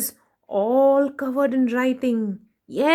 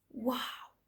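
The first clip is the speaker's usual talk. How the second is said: soft hushed wow; whispered wow; 16 dB quieter than usual. Acted. Storyteller whispering wonder to children. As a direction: breathe it in wonder.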